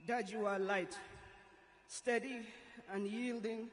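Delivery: usual speaking voice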